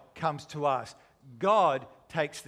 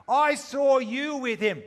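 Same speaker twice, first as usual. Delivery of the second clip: higher, louder acted accusing call; loud accusation; 6 semitones higher, 5 dB louder than usual. Bystander confronts Peter outside the courtyard, loud accusation. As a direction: call him out, pitched up.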